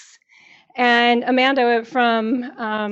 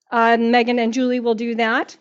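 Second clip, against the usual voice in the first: ordinary talk, nothing marked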